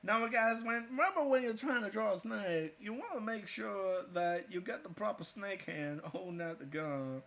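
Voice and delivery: lightly hammed-up how-to host; mock tutorial voice. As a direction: play the earnest art instructor. Mock drawing tutorial address.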